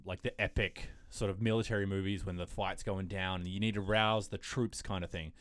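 usual speaking voice

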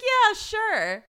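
put-on high, loud dismissive old man; senile old man. Podcaster mimics senile detective, high and loud. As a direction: brush them off like a doddering old man.

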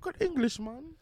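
very high, squeaky mock English accent; attempted English accent. Insisting to friend it's English, high put-on English accent. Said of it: "that sounds Irish".

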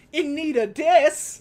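mock incredulous. Kid mocking the donation sign.

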